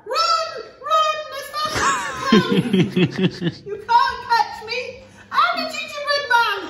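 high, loud, laughing gingerbread man; laughing taunt. Acted. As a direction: giggle through the taunt.

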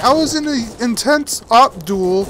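high, loud indignant rant; outraged shout. Real, not acted. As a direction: complain loudly in disbelief. Outraged at enemy spawn.

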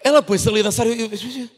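ordinary talk, nothing marked

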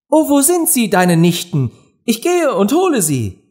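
very high, excited little-Alice voice; curious, eager. A narrator's reading. Alice looks around for the nieces, eagerly curious. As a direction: ask eagerly, pitched right up. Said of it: "sah sich neugierig nach allen Seiten hin um".

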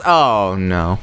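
ordinary talk, nothing marked

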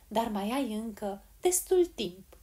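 ordinary delivery

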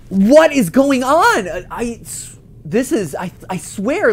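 high, loud and slow alarm. Real, not acted. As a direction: ask it in real alarm. Game suddenly warns player.

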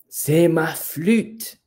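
high, slow, drawn-out exclamation; delighted discovery. Acted. The peacock finds his flute behind his tail, delighted discovery. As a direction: announce the find with relish.

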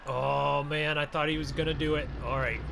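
usual speaking voice

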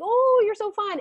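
high, squeaky excited puppy. Retold or imitated. Puppy meeting another dog, high excited squeal. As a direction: gush like a thrilled puppy at a new friend. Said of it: "sees another dog and is like".

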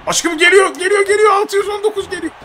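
high, loud excited yelling; excited shouting. Real, not acted. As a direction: shout it out, thrilled. Hyped at incoming finisher.

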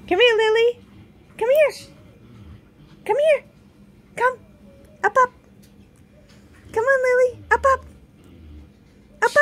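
high, loud coaxing call; coaxing a pet. Real, not acted. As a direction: coax the pet up, high and eager.

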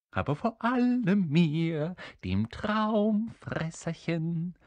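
high, excited imp voice; eager little creature. Acted. Little creature promising help to all three, eager. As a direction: pipe it up, drawing out the last words.

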